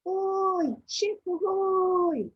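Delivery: loud, high, drawn-out hail; sailor's shout. Acted. Sailor hailing another ship, long loud shout. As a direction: shout it slowly across the sea. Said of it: "Can you shout that with me?".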